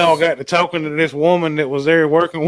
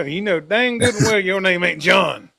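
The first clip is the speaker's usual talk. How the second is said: normal talking voice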